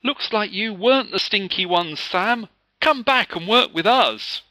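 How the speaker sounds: high, loud cheerful call; girl inviting Sam back to her group. A narrator's reading. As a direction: call across the room kindly. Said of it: "Angelina called out".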